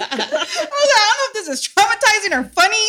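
high, loud words through laughter; laughing while recalling the scene. Retold or imitated. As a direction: giggle it out in a squeaky voice. Retold, laughing.

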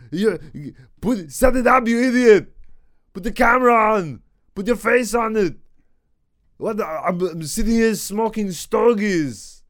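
a decent Arnold Schwarzenegger impression. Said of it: "that's a pretty decent Arnold".

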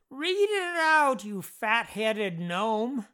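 high, loud shout, drawn out. A narrator's reading. Jeff heckles James as he reads, shouting high and slow. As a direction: shout it slowly as an insulting order. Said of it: "Jeff shouted".

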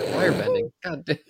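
high squeal at own slip; shocked high yelp; squeaky disbelief. Real, not acted. Mocking own misread word.

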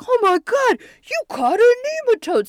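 high, loud astonished cry; over-the-top shrieky disbelief; mock amazement. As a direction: gasp it out like an awestruck cartoon bully. Acted amazed bully in retelling.

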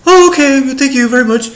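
very high, loud, sugary giving-in voice; meek polite reply. Retold or imitated. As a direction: squeak out a sweet surrendering thank you. Meek reply to phone agent.

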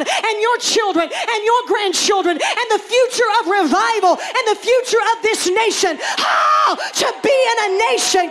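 high, fervent pulpit shout; preaching at climax. Acted. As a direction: build to a rousing, cried-out peak.

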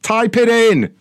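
loud mocking shout; angry sarcastic yelling. Real, not acted. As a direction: shout the insult.

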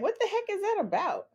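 high, fast disbelief; surprised. Retold or imitated. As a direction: blurt it in disbelief. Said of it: "so I was like".

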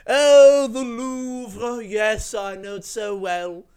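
a shrieked high oh, then slow smug boasting; mock-rapturous snob gushing; snobby rich traveller.

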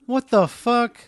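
high, loud cry of disbelief; baffled outrage. Acted. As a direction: exclaim in shocked bewilderment. Dead man's wound starts healing.

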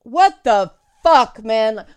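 loud, slow, disbelieving curse; incredulous outburst. Retold or imitated. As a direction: drag out the curse in furious disbelief. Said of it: "And I'm like".

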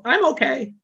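put-on high, light child's voice. A toddler reassuring the parent, high childlike voice. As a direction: brush it off like a small kid. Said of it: "the kid might go".

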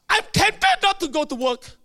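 high, loud complaint; hammed-up morning protest; acted temptation. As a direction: belt it out like a reluctant worker. Acts reluctant worker.

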